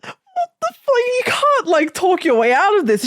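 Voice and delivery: high-pitched, hammed-up disbelief; can't-believe-this high voice; incredulous reaction. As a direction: squeak it out in mock outrage. Retelling outraged disbelief at a scene.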